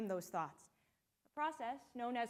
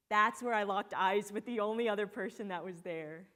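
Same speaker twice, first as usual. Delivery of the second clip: ordinary talk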